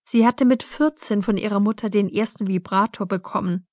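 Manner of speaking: ordinary delivery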